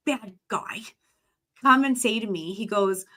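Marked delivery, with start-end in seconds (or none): 0.1-0.8 hushed whisper on "bad guy". Real, not acted. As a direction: drop to a whisper for effect. Dramatic aside about a gangster.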